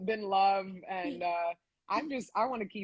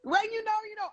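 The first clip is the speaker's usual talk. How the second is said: high, bright echo of the phrase "When you know, you know."; excited echo; 13 semitones higher, much faster than usual. Real, not acted. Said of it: "echoes S1 line".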